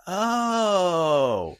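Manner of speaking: long, high drawn-out reaction; dawning realization. Retold or imitated. Daughter hearing the password clue from her sister, imitated realization.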